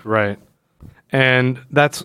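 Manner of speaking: normal talking voice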